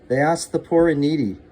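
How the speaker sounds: ordinary delivery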